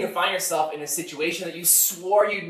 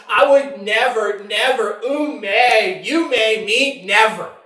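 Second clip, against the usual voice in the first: high, loud, drawn-out "never"; mock absolute certainty; emphatic vow; 5 semitones higher, 6 dB louder, much slower than usual. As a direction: hammer each "never" slowly. Voices overconfident vow, building.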